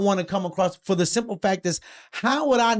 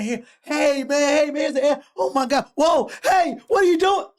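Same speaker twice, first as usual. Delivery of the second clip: high, excited greeting rising into panic; hammed-up yelps, pitch climbing higher and louder; mock alarm; 9 semitones higher, 3 dB louder than usual. Human meeting an angry alien, mock alarm.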